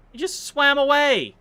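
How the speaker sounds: can't believe the fish escaped; indignant surprise. Real, not acted. Streamer watching a fish escape, loud indignant surprise.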